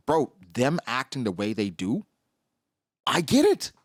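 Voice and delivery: ordinary delivery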